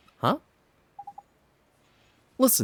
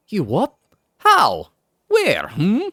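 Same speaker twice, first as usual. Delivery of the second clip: louder, higher startled Welsh questions; surprised Welsh Drippy; 9 semitones higher, 9 dB louder, slower than usual. Acted.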